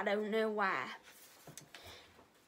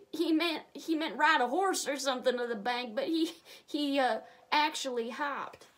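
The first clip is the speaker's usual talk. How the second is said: plain reading voice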